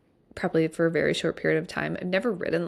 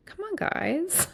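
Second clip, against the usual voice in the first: higher, slow whiny come on guys; drawn-out, high embarrassed protest; mock protest; 11 semitones higher, 3 dB quieter, slower than usual. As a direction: playfully plead with the teasing group. Friends tease her as sassiest.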